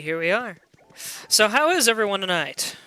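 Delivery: ordinary talk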